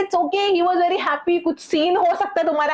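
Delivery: placating friends. Retold or imitated. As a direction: imitate friends brushing off a complaint. Friends placate her in quarrel.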